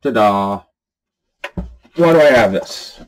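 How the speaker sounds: ordinary talk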